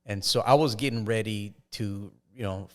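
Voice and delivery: normal talking voice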